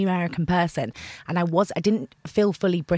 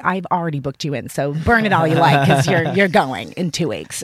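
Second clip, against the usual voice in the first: firm mother; 6 dB louder than usual. Retold or imitated. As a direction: lay down the law. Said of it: "She's like".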